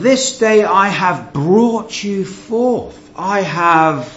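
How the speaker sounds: lightly voiced God, spoken slowly; right reading. Shown as a demonstration. Preacher voicing God's true meaning, slow and solemn. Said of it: "He is saying".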